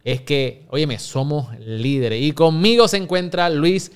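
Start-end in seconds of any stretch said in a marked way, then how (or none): 2.2-3.9 slow, loud, pitched-up introduction of the guest's name; hype intro announcement. Acted. Host hypes his guest to the listeners, slow and loud. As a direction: announce Luis Félix Caballero like a ring announcer.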